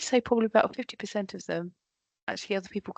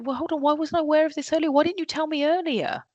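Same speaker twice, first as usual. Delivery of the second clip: higher, quick, exasperated questioning; exasperated manager; 7 semitones higher, faster than usual. Retold or imitated. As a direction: play the boss caught out by late news. Manager annoyed at late news.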